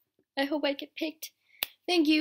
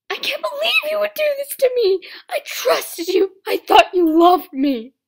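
screaming, much higher and louder; betrayed furious shout; 12 semitones higher, 14 dB louder than usual. Acted. A betrayed character screaming at her lover, furious. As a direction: hurl the betrayal at them. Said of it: "Sorry if it's a bit loud".

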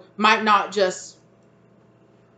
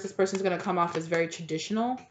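ordinary delivery

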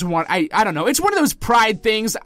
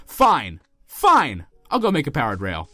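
ordinary talk

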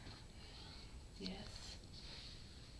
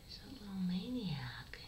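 soft unvoiced teasing; hushed affectionate whisper; whispered tease; 5 dB quieter than usual. Real, not acted. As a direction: whisper it fondly.

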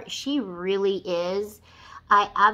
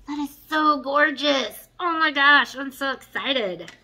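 excited; 5 semitones higher, 6 dB louder than usual. Real, not acted. Gushing over shimmer.

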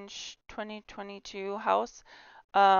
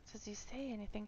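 breathy whisper to herself; hushed aside mid-tour; 16 dB quieter, faster than usual. Real, not acted. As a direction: whisper it so no one hears. Quiet aside while recording.

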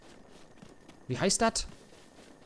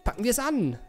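put-on higher, chirpy girlish voice; girl's voice impression; 5 semitones higher than usual. Streamer voicing the girl starting repairs, higher. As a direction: chirp it like a busy young scientist. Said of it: "Stimme vom Leitsteinchen gut imitieren".

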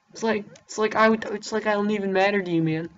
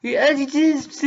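normal talking voice

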